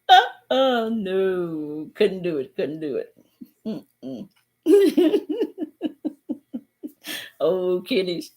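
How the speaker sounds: ordinary talk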